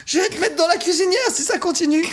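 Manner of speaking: high put-on angry mom threat; meme voice. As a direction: deliver the meme line as a cartoonish threat. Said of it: "un de mes mèmes préférés".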